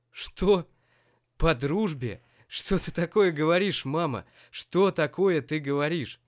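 loud, high outraged shout; yelling. A narrator's reading. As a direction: howl it in disbelief. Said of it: "завопил Семён".

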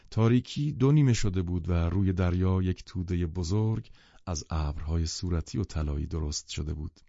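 ordinary delivery, nothing marked

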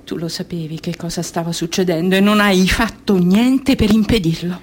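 mounting, pressed reproach; accusing. Acted. Inner voice blames the woman for doing nothing, accusing. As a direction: press the accusation home to the end.

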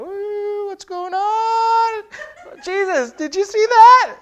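hammed-up guilty surprise; mock nervous cover-up. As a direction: fake innocent squeal. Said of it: "Be like".

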